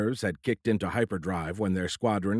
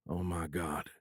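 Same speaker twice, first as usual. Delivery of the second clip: much softer, barely above a breath; hushed in disbelief; whispered; 4 semitones lower, 10 dB quieter, slower than usual. A narrator's reading. A hint of a whisper. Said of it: "Jake whispered".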